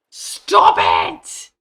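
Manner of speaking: explosive angry yell; mad. Real, not acted. Gamer to the enemy that keeps attacking, mad yell. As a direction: shout it at full force, exasperated. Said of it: "Sorry, I'm really mad about it".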